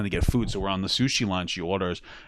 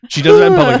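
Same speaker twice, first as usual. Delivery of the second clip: exasperated outburst; 13 dB louder, faster than usual. Real, not acted. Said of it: "exasperated repeat".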